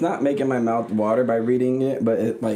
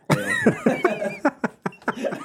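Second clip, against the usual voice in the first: high giggle; 13 semitones higher, 3 dB louder than usual. Real, not acted. Laughs at own slip.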